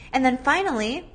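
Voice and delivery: ordinary talk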